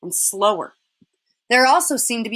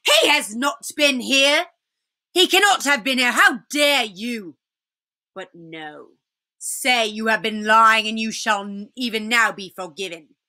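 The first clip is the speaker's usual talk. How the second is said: outraged witch. Acted. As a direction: deny it furiously, then offer a cold bargain. Witch denies the fox's news.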